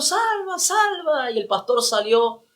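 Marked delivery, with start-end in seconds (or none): 0.0-1.3 high-pitched call across the church; high, urgent call of a name. Retold or imitated. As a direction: call his name out from a distance. Calling out to the pastor.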